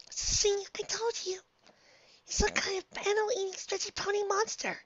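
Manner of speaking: high, soft timid voice; shy little voice; timid pony voice. Acted.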